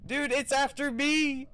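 loud panicked cry; being chased. Real, not acted. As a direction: shout it in fear. Chased by ghost.

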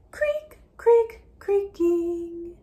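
creaky sound words. Acted. Teacher reading to a child, creaking like a door. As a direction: squeak it like an old door.

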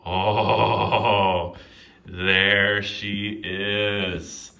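loud drawn-out triumph; triumphant. Real, not acted. Opening the box at last, slow triumphant. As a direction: savour the win slowly.

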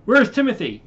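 asking after the missing crewman; alarmed question. Acted. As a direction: demand it with alarm.

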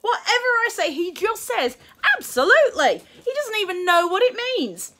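high, loud furious yell; shouting madly. A narrator's reading. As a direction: explode at mum in frustration. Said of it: "shouted William madly".

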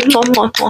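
playful kissing sounds; blown kisses. Real, not acted. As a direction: send kisses to the viewers. Blowing kisses at video end.

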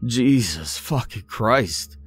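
high, drawn-out horrified cry; horrified scream. A narrator's reading. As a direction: scream in shock at the killing. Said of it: "I screamed".

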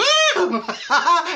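high mock scream of fright; comic high-pitched fright noise; frightened shriek. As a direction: yelp as if startled by a face. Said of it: "you get a, a fright and you go".

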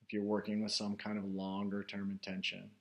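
guided meditation. Real, not acted. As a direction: guide listeners inward, unhurried, phrase by phrase. Leads a guided meditation after chat.

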